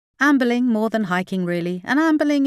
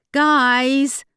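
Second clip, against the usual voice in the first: Guys! called out louder and higher; a little louder; 7 semitones higher, 6 dB louder than usual. Acted. Carly calling to her arguing friends, louder. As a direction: raise your voice to get their attention. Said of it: "Carly repeated a little louder".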